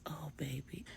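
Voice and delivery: whispered, worried grandmother; anxious whisper. A narrator's reading. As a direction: breathe it out in fear. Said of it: "Miss Brenda says".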